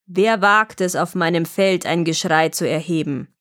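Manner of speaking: low, affronted dragon voice; roaring dragon. A narrator's reading. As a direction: challenge the intruder as an angry dragon. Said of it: "schrie der Drache". (tiefe, brüllende Drachenstimme)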